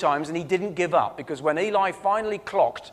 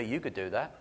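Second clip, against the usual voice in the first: low, soft inner prompting; little inner voice; 6 semitones lower, 9 dB quieter than usual. Retold or imitated. The Lord prompting him during prayer, little inner voice. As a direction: say it softly and low, as a voice inside. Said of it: "had a little voice inside me say".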